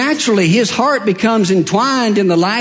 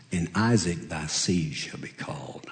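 breathy whispered pronouncement; soft whispered divine voice; hushed voice of God; 11 semitones lower, 13 dB quieter than usual. Acted.

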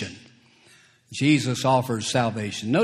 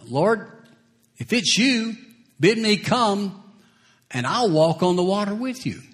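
voicing Peter; 6 semitones higher than usual. Preacher voices Peter pleading.

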